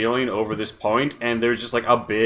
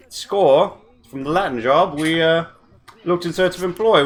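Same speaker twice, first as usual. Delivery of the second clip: British rogue voice; 3 semitones higher than usual. Acted. GM as drunk hatter boasting to the party, British voice. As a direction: play a boozy British mercenary spinning a tale.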